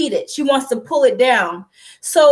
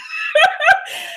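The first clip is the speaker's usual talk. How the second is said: high embarrassed laugh through tears; a short tearful laugh; teary laugh. Real, not acted. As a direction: laugh off the crying. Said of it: "I didn't mean to cry".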